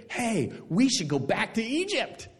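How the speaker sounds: high-pitched mock bright idea; grumbling Israelites. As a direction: imitate the people urging a return. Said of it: "And they go".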